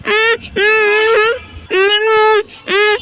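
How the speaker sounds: muffled humming through a tied mouth; gagged mumbling. Acted. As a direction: try to talk with the mouth bound shut. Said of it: "बोला ही नहीं जा रहा". (बंधे मुंह से घुटी हुई उम-उम)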